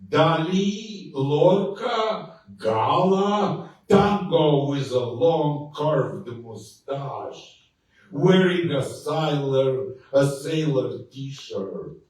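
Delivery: slow, emphatic declaiming; poetry reading. Acted. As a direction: read the poem with mounting drama. Poem reading Barcelona Tango.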